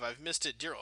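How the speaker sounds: ordinary talk, nothing marked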